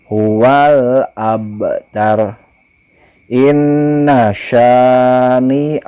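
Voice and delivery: slow, melodic chant with long vowels; Quran recitation. Shown as a demonstration. Teacher models the verse for pupils, slow chant. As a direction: recite slowly, stretching each vowel. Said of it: "hukumnya gunnah".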